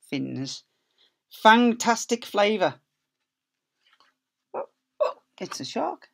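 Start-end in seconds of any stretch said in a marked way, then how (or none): none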